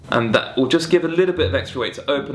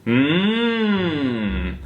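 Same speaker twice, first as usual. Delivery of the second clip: rising hummed mmm; 3 semitones higher than usual. Shown as a demonstration. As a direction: hum sliding up. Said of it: "so you're going".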